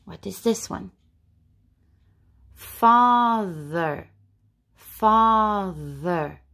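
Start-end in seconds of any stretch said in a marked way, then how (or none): 2.5-6.4 FATHER stretched, with a buzzing th in the middle; voiced TH. Shown as a demonstration. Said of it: "Father makes the th".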